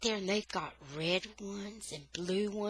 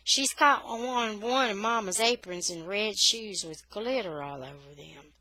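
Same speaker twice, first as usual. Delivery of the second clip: ordinary delivery, nothing marked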